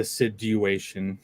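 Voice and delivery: "situation" said slowly as sid-you-ation; "situation" with a soft d and a full "you"; Australian-style situation. Retold or imitated. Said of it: "Love how Australians say situation".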